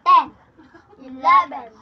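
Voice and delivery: counting out in a loud voice. Real, not acted. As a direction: call out each number loudly. Counting loudly during a challenge.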